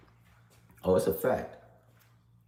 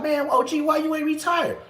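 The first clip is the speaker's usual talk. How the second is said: high, mocking youngster voice; teasing young inmate; 12 semitones higher than usual. Young inmates tease the veteran officer, high mocking voice. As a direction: needle the old guard, pitched way up.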